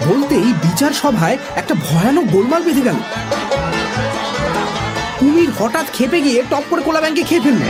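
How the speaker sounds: ordinary delivery, nothing marked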